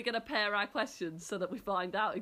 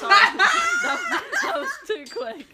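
laughing; 13 dB louder than usual. Real, not acted. Laughs at own fast answer.

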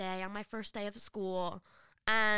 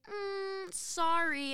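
mock apology; 9 semitones higher, much slower than usual. Planned fake apology to girl.

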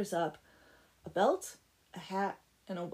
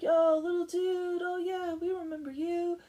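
chummy dude greeting; 9 semitones higher, 5 dB louder than usual. Acted. Dudes recognizing the blacksmith at the door, chummy. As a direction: hail an old pal in a higher voice.

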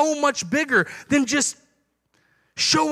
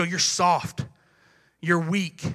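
lower, contemptuous jab; mock sneer; 6 semitones lower, 5 dB quieter, slower than usual. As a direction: pastor voicing the world sneering at a gentle believer, lower and contemptuous. World sneers at believer.

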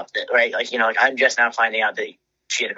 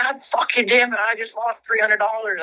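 much higher, anguished mock wail; roommate losing a bet; 11 semitones higher than usual. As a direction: imitate the roommate wailing over his lost money.

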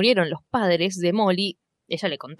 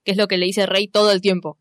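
usual speaking voice

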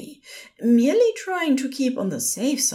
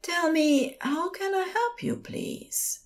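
soothing; 9 semitones higher than usual. A narrator's reading. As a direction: gently coax a distraught patient to speak. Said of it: "dropped into the soothing panic voice".

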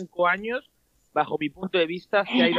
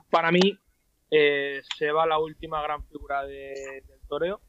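usual speaking voice

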